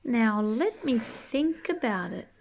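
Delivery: ordinary delivery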